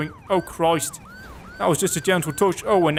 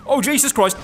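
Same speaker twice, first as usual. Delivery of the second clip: real shocked shout, higher and louder; startled outburst; 8 semitones higher, 5 dB louder than usual. Real, not acted. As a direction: blurt it out as the car hits. Sudden crash while driving.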